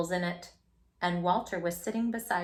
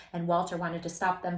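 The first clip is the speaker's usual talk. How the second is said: ordinary delivery, nothing marked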